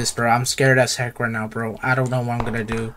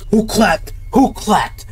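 higher, tense asking; alarmed question; 7 semitones higher, slower than usual. Real, not acted. Player hearing a clap, tense and scared. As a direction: ask it sharp and scared.

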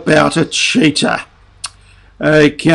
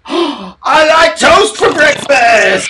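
excited shout; 8 semitones higher, slower than usual. Acted. Excited intro to toast song.

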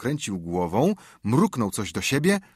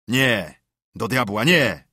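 forceful "no", louder on the last word; emphatic refusal; 5 dB louder, slower than usual. Acted. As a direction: burst out in sudden objection. Bandit abruptly rejects reburying the treasure. (stanowczy, głośny sprzeciw)

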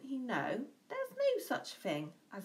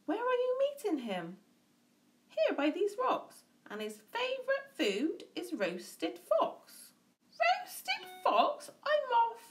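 high, nervous fox; 13 semitones higher, 3 dB louder than usual. A narrator's reading. Fox fleeing from the mouse's story, high and panicky. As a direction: pitch it way up like a jittery fox. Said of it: "Fox said".

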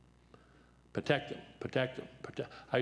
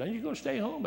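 high voice of a questioning wife; wife's voice; 12 semitones higher than usual. Retold or imitated. Wife asking if he'll retire, high put-on voice. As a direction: pitch it up like his wife asking.

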